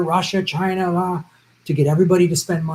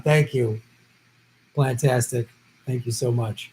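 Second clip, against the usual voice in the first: normal talking voice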